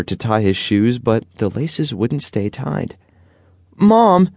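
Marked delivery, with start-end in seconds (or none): 3.8-4.3 loud, very high call to another room. A narrator's reading. Boy calling his mom from the living room, loud high call. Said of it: "Dominic called".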